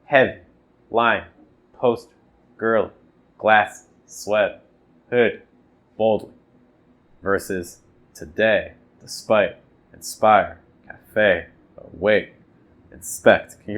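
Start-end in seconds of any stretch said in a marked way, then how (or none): 0.1-6.3 "Heavy", "lion", "poster" each hit on the first beat; trochee list. Shown as a demonstration. Teacher reading trochees aloud to compare the rhythm. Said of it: "Let's just do a trochee". 7.2-13.6 "today", "despite", "awake" stressed on the end, slow; iamb list. Shown as a demonstration. Teacher contrasting iambs with trochees, stress at the end. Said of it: "hear a difference".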